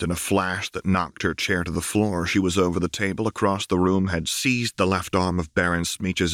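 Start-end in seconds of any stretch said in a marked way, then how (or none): none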